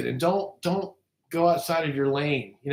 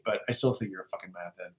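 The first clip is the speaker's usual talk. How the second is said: quick, much softer mumble under the breath; muttered aside; 8 semitones lower, 9 dB quieter, faster than usual. Pretend. Muttering an insult about Mongo meant to stay unheard. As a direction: slip it out fast as if nobody should hear. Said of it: "did I say that out loud?".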